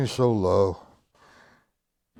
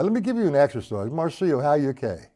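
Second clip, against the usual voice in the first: usual speaking voice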